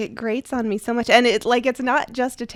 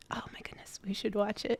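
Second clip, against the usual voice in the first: whisper; 10 dB quieter, faster than usual. Real, not acted. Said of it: "whispered aside".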